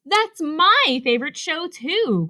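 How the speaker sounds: high, thrilled exclamation; high-pitched eager outburst; excited cry. A narrator's reading. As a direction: cry it out with delight. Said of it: "cried Rabbit".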